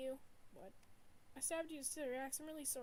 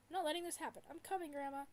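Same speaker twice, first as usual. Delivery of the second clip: determined; 4 semitones higher, 7 dB louder than usual. Acted. Determined to rescue.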